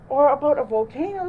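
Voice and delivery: high, loud, quick little mole voice; eager Mole. A narrator's reading. Mole chimes in asking for volcano books, high and fast. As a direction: pipe up quickly. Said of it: "said Mole".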